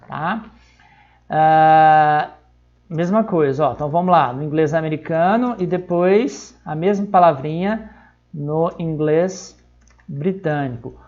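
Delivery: normal talking voice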